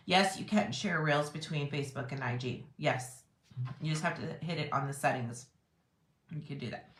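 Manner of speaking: hoarse, fading voice; losing voice. Real, not acted. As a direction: voice giving out. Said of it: "Sorry, I'm losing my voice".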